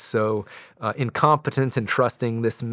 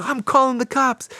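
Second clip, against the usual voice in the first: very high, louder imitated yell; mock threat; 4 dB louder than usual. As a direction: shout the threat like an outraged kid. Ephraim threatening the valet.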